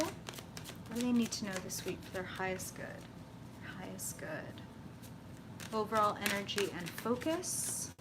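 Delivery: hushed tarot invocation; quiet invocation. Acted. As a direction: murmur the request like a prayer. Invokes Spirit before the draw.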